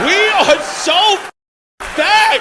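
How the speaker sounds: slow, stretched, high and loud victory cry; mock triumphant shout. Comic crowing to the Miami crowd, hammed-up loud and high. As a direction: drag it out like a hammed-up rally chant.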